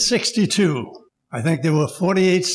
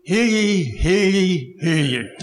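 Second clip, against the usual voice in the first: town crier; 3 semitones higher, slower than usual. Pretend. Mock proclamation opening tribute.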